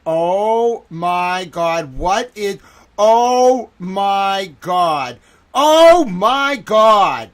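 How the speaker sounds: drawn-out, appalled outcry; mounting horror. Real, not acted. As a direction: wail in disbelief, each cry bigger. Horror mounting as streamer watches footage.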